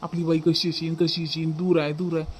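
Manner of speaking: a light, mocking imitation of the mother's fretting; mocking mother's longing. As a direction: brother teasingly voices the mother's pining for her daughter, half-mocking. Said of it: "तू सर्वच आठवण करत राहतं".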